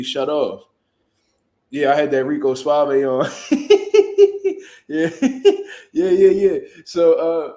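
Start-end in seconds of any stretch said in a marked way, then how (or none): 3.4-4.6 loud, high cackle; real high-pitched laugh. Real, not acted. Laughing at his own story. 5.2-5.9 breathy laugh. Real, not acted. Laughing again.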